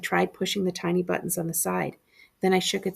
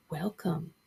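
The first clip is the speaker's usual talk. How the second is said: softer, breathy ghostly welcome; soft whisper; 6 dB quieter than usual. A narrator's reading. Shadow girl welcomes the boy in a soft whisper. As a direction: float the word on the cold air. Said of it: "her voice a soft whisper".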